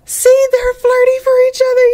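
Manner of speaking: high, loud delighted squeal. Real, not acted. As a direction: squeal with joy at them. Delighted at the couple flirting.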